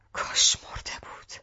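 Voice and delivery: broken whispered echo. Acted. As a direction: let it fall into a hoarse whisper. Mother repeats after shouting.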